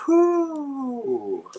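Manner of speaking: long high exhale of relief; shaky relieved blow; relieved whew. Real, not acted. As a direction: blow out the tension. Relief after fright.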